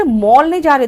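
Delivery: ordinary delivery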